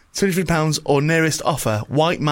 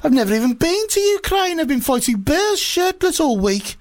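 much higher, hammed-up indignant Russian accent; mock Russian Putin; 12 semitones higher than usual. Putin denies everything to Obama in a high, hammed-up Russian accent. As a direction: protest innocence like an outraged cartoon Russian. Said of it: "probably a Russian one".

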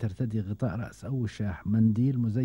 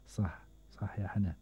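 whispered aside; 7 dB quieter than usual. Real, not acted. Quiet aside to caller.

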